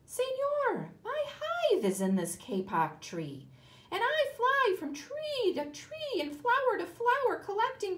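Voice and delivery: high, bright little bee; a bee pleading in a man's ear; buzzy bee voice. Acted. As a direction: flit up high like a busy bee. Bee voice in story.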